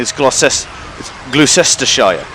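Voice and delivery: "Gloucestershire" as "gloo-SESS-ter-shire"; as written. Shown as a demonstration. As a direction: sound out "Gloucestershire" letter-faithfully, every syllable full. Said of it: "pronounce it as it's written".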